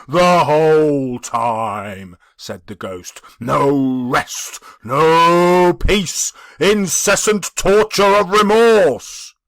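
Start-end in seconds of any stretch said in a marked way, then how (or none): 0.1-2.2 slow, heavy spectral voice; solemn ghost. Acted. The ghost speaks. 3.4-9.3 loud, slow spectral lament; a restless soul mourning its fate; anguished ghost. Acted. The ghost speaks.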